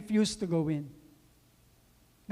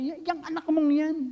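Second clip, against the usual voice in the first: high, loud spitting out of "yang anak mong yan"; angry contempt; 10 semitones higher, 6 dB louder than usual. Acted. Older son refusing to call him brother, furious high sneer. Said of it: "Dahil galit na galit siya".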